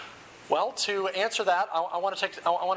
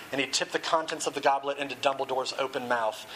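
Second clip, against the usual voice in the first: usual speaking voice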